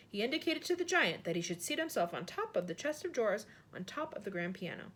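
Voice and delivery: ordinary delivery, nothing marked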